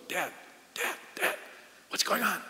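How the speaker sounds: daughter's hushed whisper, imitated; hushed, whispered mimicry of a puzzled daughter; retold whisper. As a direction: whisper urgently like a child trying to get dad's attention. Retold whispering child during service.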